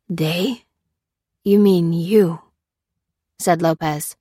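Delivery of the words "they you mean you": halting, effortful slurred words; slurred speech. A narrator's reading. Anesthetized prisoner answers Lucy, slurred. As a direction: struggle to form each word, as if anesthetized. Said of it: "His words were slurred and sounded difficult".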